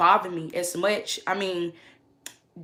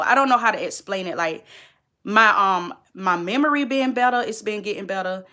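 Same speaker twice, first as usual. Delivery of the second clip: usual speaking voice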